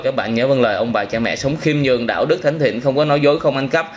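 ordinary talk, nothing marked

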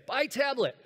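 high, loud blurt; crazy shopper's shout. Acted. Shopper walking into Best Buy shouting a search query, crazy loud shout. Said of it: "like a crazy person".

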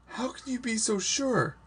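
very high, distressed question; anxious high-pitched challenge. A narrator's reading. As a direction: press for an answer, pitch way up. Condemned girl arguing with her friend.

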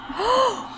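high, quick gasp; shocked gasp. Real, not acted. Player sees the rescue fail, shocked gasp. Rescue fails, sim is dying.

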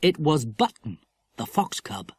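ordinary delivery

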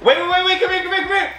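high excited calling out. Real, not acted. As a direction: call her back, laughing. Calling her back, excited.

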